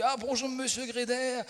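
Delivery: high-pitched hearty greeting; put-on high, cheery hello; jovial banker. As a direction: imitate a comic banker greeting a client. Acts out jovial banker greeting him.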